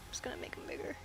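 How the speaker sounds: whispered to herself. Real, not acted. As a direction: breathe it under your breath while working. Drawing, muttering to herself.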